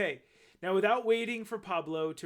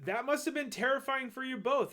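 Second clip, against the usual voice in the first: higher-pitched mom voice; sympathetic mom; 8 semitones higher than usual. A narrator's reading. Mom responds to her scared son, sympathetic. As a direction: lightly voice a concerned mother.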